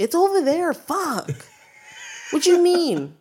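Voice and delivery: loud, high-pitched exasperated snapping; irritated. Retold or imitated. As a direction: act out losing patience with a customer. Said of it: "I get fucking irritated".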